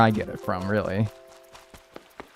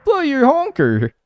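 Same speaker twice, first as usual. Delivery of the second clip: amused laughing teasing; 7 semitones higher than usual. Real, not acted. Laughing at stuffy voice.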